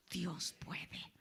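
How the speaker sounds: soft whispered reply. Real, not acted. Preacher answering his own question, whispered. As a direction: breathe the answer out.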